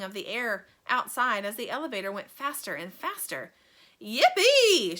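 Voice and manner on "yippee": long high whoop; joyful shout. A narrator's reading. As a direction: whoop with glee. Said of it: "shouted Grandpa Joe again".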